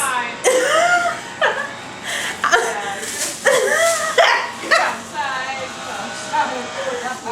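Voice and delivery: helpless laugh that tips toward crying; long wheezy laugh breaking up; long laughing fit. Real, not acted. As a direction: laugh until it almost breaks. Laughing fit mid-vlog.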